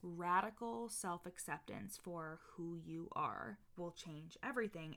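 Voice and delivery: usual speaking voice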